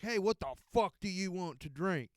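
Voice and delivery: hammed-up cheeky bartender bark; a hint of a rowdy bartender calling out. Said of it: "I'm the cheeky bartender".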